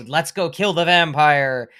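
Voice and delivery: loud, slow, rousing hero's cry; hammed-up brave rallying call; mock courage. Host mocks Peter suddenly finding his courage, mock heroic. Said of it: "dormant courage and go".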